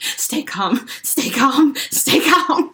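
rising mock panic. Calming herself amid the crocodile.

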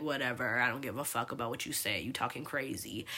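low, soft, unbothered brush-off; dismissive. Retold or imitated. Daughter tuning out her ranting dad, retold with quiet indifference. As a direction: tune him out under your breath. Retelling brushing off dad's rant.

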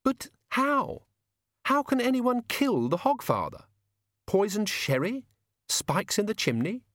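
high-pitched woman demanding answers; incredulous, voice pitched right up; Susan, disbelieving. A narrator's reading. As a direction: question it in a high, shocked voice. Susan questions Death, shocked.

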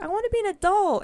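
put-on high, loud and fast like an eager child; a child wishing to be grown up, eager and fast; eager kid. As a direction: rush it out like a kid who can't wait to grow up. Voicing eager younger self.

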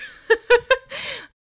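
very high, loud laugh; real burst of laughing after a fright; startled laughter. Real, not acted. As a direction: crack up in disbelief.

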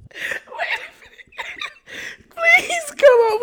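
whispered through helpless giggles; can barely get it out laughing; breathless laughing. Real, not acted.

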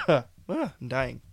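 normal talking voice

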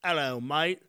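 British accent. Shown as a demonstration. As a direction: have a rough go at British. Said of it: "let's hear British".

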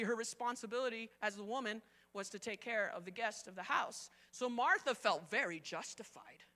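ordinary delivery

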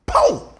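very high, loud "pow"; imitated slap. Preacher re-enacting a slap before people, high explosive "pow". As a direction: yelp out the smack.

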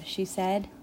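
usual speaking voice

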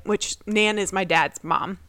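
ordinary delivery

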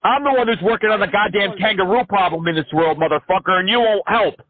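high, excited ranting crank; manic caller. Acted. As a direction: rant like an unhinged crank caller. Crank caller rants at scammer.

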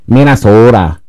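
exaggerated Midwestern Minnesota vowels; Minnesota accent. Mimics Minnesotan pronunciation.

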